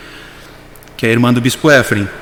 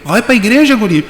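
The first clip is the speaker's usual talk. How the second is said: Vera surpresa; 7 semitones higher than usual. Retold or imitated. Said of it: "Ela falou".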